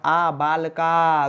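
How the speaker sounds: बालका stretched out very slowly; teacher slowly joins आ to बालक; drawn-out demo. Shown as a demonstration. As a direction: spell बालका out slowly, sound by sound. Teacher builds the word slowly.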